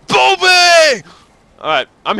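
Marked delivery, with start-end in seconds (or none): 0.1-1.0 higher, loud, drawn-out desperate calls; hammed-up yelling for Bobby. Begging Bobby for a push, hammed-up desperate yelling. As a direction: bellow for Bobby like he's drifting away. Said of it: "that voice".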